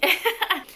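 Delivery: short high laugh; real laugh. Real, not acted. Storyteller admitting they were talking to someone, amused laugh. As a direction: burst into a quick laugh.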